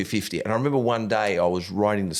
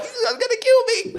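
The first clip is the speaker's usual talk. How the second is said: very high, laughing squeal of fake terror; imitating the terrified driver through laughter; scared nerd voice; 4 dB louder than usual. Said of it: "and he's like".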